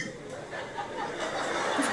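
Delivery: voiceless wheezing laugh, fairly soft; breath-only laughing fit; real laugh. Real, not acted. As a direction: laugh helplessly at a bad impression. Reacting to bad accent.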